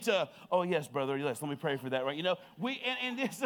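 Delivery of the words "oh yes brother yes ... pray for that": suddenly low, smooth mock-pious reassurance; put-on pastoral calm. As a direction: switch to a composed, kindly minister. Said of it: "Pastor Justin shows up real quick".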